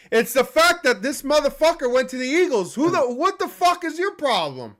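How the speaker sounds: high-pitched, indignant outburst; real angry rant. Real, not acted. Fan fuming at player joining Eagles, angry. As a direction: rant as if personally betrayed. Ranting about rival signing.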